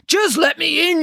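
high, rough, impatient wolf. A narrator's reading. Wolf losing patience with doubting piglets, rough voice. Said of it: "Your voice is too rough".

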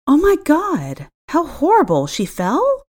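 high, slow gasp of shock. A narrator's reading. Shocked gasp hearing a coworker fell. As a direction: react as if stung by bad news. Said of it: "I said with a gasp".

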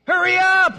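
high loud hurry up; impatient shout. Acted. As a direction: bark it out impatiently. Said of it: "He says".

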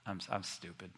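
low, hushed, halting self-reproach; humbled Job. Acted. Job admits to God he was wrong, humbled and hushed. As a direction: say it small and low, stopping between phrases. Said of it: "Job said".

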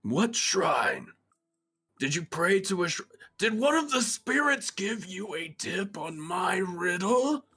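high, grumbling tree voice; a guardian tree sulking about spirits; annoyed ancient tree. Acted. Tree annoyed at being tricked.

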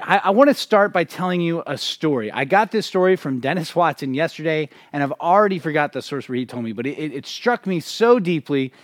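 ordinary delivery

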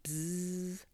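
droning insect buzz, held; bumblebee buzz. A narrator's reading. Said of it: "just a very big bumblebee".

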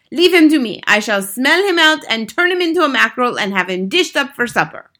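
put-on high, loud accented witch threat; menacing Grand High Witch. A narrator's reading. Grand High Witch promising witches she'll catch him, menacing. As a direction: relish the threat loudly as the witch.

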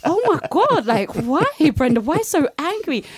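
very high, loud exaggerated disbelief; mock shock at herself. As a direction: squeal at your past self, hammed-up. Mocking her old angry writing.

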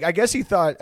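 usual speaking voice

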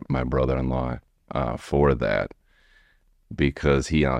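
normal talking voice

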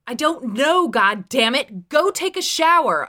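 loud, high, exasperated order; forceful. A narrator's reading. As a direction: shout it with mounting anger. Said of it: "said even more forcefully".

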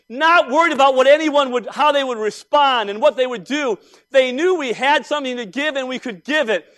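full-force preaching. Acted. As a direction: drive the joy home to the congregation.

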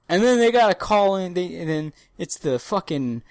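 ordinary talk

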